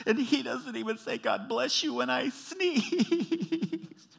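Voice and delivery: hammed-up high, loud wail building in crescendo; mock-sobbing outburst, dragging out the last word; tearful blurt. Wife blurting her grievance to the counselor, tearful crescendo. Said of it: "blurted out ... goes in crescendo with tears".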